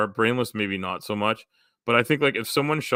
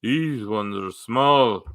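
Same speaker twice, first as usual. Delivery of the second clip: slow, drawn-out attempt at the Father Ted accent; 4 semitones higher, 4 dB louder, slower than usual. Shown as a demonstration. Trying a Father Ted line after admitting he can't do it, slow attempted accent. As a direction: spell it out slowly in an unsure Father Ted accent, rising on the second half. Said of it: "I don't think I can do the Father Ted accent".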